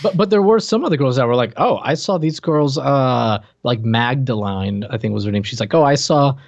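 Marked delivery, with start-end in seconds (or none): none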